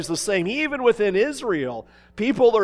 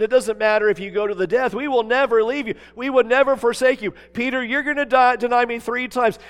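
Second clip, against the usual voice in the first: fast, rising, louder insistence; eager vow; 4 semitones higher, 5 dB louder than usual. Retold or imitated. Peter swearing loyalty to Jesus, fast and louder. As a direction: rush the promises, climbing higher.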